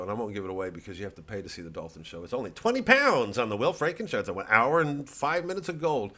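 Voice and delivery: usual speaking voice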